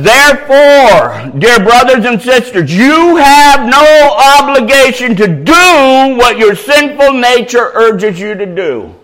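loud, high, slow proclamation; ringing, drawn-out scripture reading; preaching scripture. Acted. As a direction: declaim the verse at full force. Reads scripture at full force.

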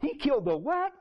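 high, rising disbelief; shocked brother. Retold or imitated. Older brother hearing the news, shocked.

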